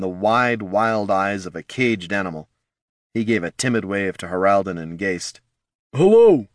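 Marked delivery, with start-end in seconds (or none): 5.9-6.5 awkward hello. Acted. Timid barbarian greets strangers.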